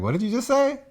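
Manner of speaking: high-pitched, put-on disbelief; mock incredulous question. Imagined Superman challenging Batman's muttering.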